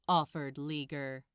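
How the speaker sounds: ordinary delivery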